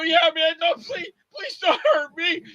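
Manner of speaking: high, tearful begging; cowardly pleading. Acted. Coward begs the attacker while friend protects him, tearful pleading. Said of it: "You played such a pussy in that".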